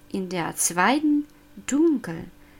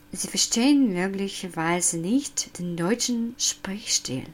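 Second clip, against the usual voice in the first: whispered aside; whispering. Real, not acted. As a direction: whisper it to yourself. Unvoiced aside.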